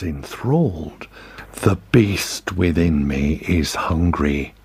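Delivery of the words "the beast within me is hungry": lower, slow and dark; low menacing voice. A narrator's reading. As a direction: let the beast growl through. Hyde's dark side stirring.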